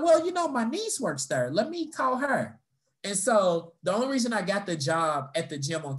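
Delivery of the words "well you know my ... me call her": high-pitched imitated supervisor; lightly acted, higher-voiced woman; helpful supervisor. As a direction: offer help in a high voice.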